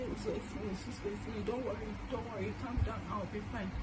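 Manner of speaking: soft, subdued reassurance; father on the phone, quietly saying don't worry; strange weak voice. Retold or imitated. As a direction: imitate a tired, hushed dad calming his daughter. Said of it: "strange voice".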